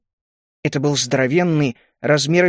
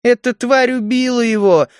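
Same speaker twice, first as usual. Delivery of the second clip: high, anguished cry; raised-pitch grieving accusation; pained outcry; 8 semitones higher, 4 dB louder than usual. A narrator's reading. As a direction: cry out with pain in the voice. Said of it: "с болью в голосе выкрикнула Галия".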